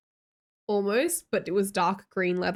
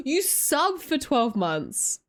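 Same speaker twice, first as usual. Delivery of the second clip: surprised; 7 semitones higher, much slower than usual. Real, not acted. Gasp at a long sub.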